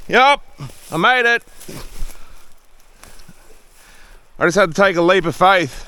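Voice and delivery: high loud triumphant shouting; thrilled he made it; excited. Real, not acted. Just survived the leap.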